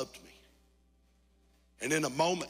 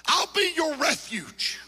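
soaring shouted climax, much higher and louder; preaching at full force; 12 dB louder than usual. Acted. Pastor promising the congregation God's comfort, full shouting preach. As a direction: preach it to the back row.

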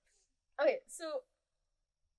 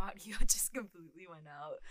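ordinary delivery

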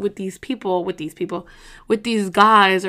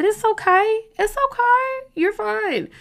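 much higher, soothing it's okay; reassuring; 12 semitones higher, slower than usual. Real, not acted. Reassuring viewers whose relationship didn't work out, gently. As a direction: comfort a friend who's hurting. Comforting viewers after a breakup.

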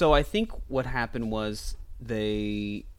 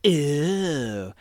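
mock Catwoman; slower than usual. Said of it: "she's like".

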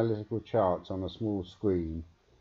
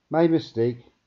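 apologetic; 7 semitones higher, 8 dB louder than usual. A narrator's reading. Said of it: "he apologized".

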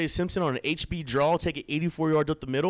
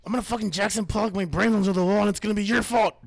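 high, loud mock meltdown; hammed-up shouting; 8 semitones higher, 5 dB louder than usual. Host piling blame on co-host, hammed-up shouting. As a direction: rant it at your co-host, hammed up.

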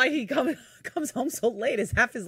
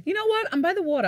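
excited; 10 semitones higher, 5 dB louder, faster than usual. Real, not acted. Defending her neighborhood.

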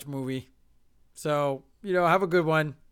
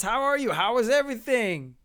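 higher-pitched polite enthusiasm; easy friendly response; 5 semitones higher, 3 dB louder than usual. Retold or imitated. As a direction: bluff through small talk, pitched up. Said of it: "the easy response".

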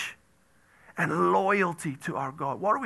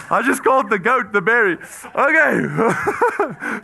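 fast, louder, laughing through the words; 5 semitones higher, 8 dB louder, slower than usual. Real, not acted. Preacher laughing at his slip before the congregation. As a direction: giggle out the confession.

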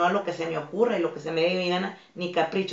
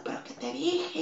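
mock yelling mother; 7 semitones higher, 5 dB quieter than usual. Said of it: "Una mamá que se agarra gritando".

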